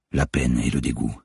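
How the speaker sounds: ordinary delivery, nothing marked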